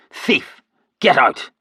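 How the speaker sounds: high, loud furious yell; enraged shout at the thief; angry shout. A narrator's reading. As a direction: drive the thief out. Shoving the thief out.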